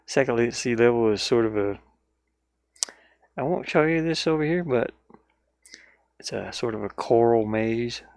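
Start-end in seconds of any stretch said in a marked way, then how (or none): none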